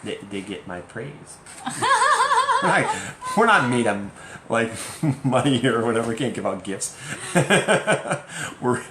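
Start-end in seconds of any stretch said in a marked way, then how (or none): 1.4-2.7 sudden high giggle; high burst of laughter. Real, not acted. Laughs at own joke. 6.9-8.6 bright high laughter. Real, not acted. Laughs at no-gifts joke.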